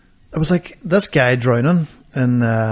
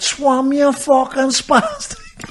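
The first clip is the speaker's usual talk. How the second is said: high, very drawn-out mock holler; mock shout; 11 semitones higher, much slower than usual. Man shouting at the drowning guy, mock shout. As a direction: stretch the call out high, no full volume. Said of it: "Your man shouted".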